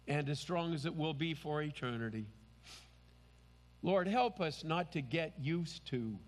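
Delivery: hushed worshipful closing prayer. Acted. Pastor closes in prayer.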